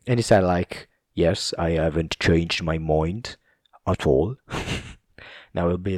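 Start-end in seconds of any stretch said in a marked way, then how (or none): 1.1-4.4 imitated Scottish accent; Sean Connery. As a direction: quote him in a mock Scottish brogue. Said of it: "so funny how he says that".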